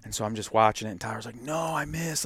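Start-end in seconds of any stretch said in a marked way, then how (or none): 1.4-2.2 freaking out. Retold or imitated. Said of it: "he's freaking out".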